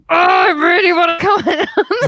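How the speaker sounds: high, loud mock-anguished cry; desperate wail. Comedian fighting the urge to comment, desperate wail. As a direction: wail it as if bursting to give in.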